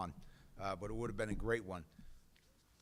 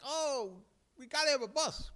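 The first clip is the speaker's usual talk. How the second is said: high whiny mock politician voice; 14 semitones higher, 3 dB louder than usual. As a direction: mimic a whining politician, pitched up high. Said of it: "politicians are like".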